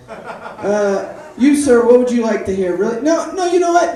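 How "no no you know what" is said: calling out. Real, not acted. Calls out to crowd.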